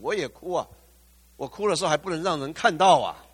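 preaching at full force. Acted. Pastor proclaiming resurrection to struggling believers, shouting fervently. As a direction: lift the room with the promise of resurrection.

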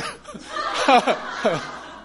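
silent shaking unvoiced laugh; airy wheezing laugh; breathy laugh. Real, not acted. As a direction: laugh without voice. Laughs recalling pastor banging table.

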